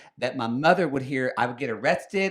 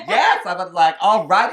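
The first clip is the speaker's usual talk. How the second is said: excited yes; 5 semitones higher, 9 dB louder than usual. Retold or imitated. Retold eager giving in.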